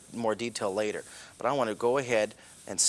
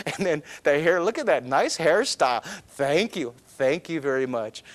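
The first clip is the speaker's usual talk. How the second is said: mock thank you; 3 semitones higher, 4 dB louder than usual. Hair admired, mock thanks.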